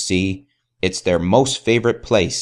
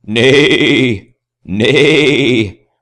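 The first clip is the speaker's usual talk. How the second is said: louder, drawn-out neighing; horse neigh; 4 semitones higher, 5 dB louder than usual. Acted. Reading to children, the horse neighs loudly. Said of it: "says the horse".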